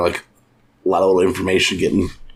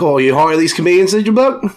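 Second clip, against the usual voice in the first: light Aussie twang, higher; Australian accent; 7 semitones higher, 3 dB louder than usual. Retold or imitated. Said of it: "anything he says, say it in Australian".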